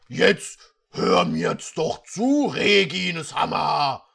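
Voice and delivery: slow, loud commanding shout; hammed-up angry shout at Regine; angry prince. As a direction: shout her down, slow and forceful. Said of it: "sagte Shadow".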